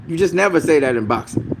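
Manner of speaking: ordinary delivery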